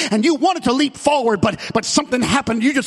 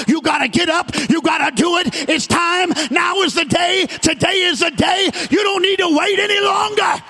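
preaching at full force; 7 semitones higher than usual. Acted. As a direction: drive every line at the congregation. Preaching climax again.

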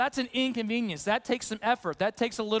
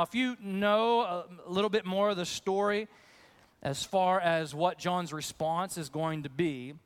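ordinary talk, nothing marked

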